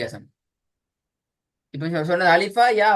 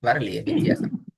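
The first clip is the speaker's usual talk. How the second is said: ordinary talk, nothing marked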